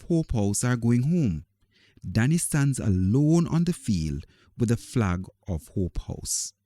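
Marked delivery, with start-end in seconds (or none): none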